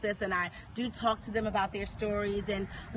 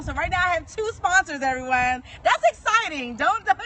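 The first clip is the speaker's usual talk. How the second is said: excited; 4 semitones higher, 6 dB louder than usual. Real, not acted. Excited announcement of sponsors.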